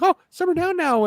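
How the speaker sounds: high, hammed-up character voice; imitating a catchphrase. Demoing his catchphrase to the co-host, high and goofy. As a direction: pitch it way up in a goofy scold. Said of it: "that's kinda become the joke".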